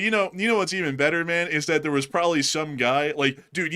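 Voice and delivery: ordinary talk, nothing marked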